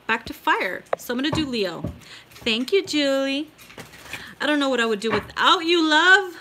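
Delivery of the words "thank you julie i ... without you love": gushing thanks. Real, not acted.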